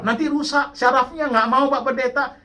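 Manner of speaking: high-pitched mock maternal protest; imitated protesting mother. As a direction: play a mother refusing the pastor, pitched high. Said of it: "Mamanya nggak mau anaknya makan obat".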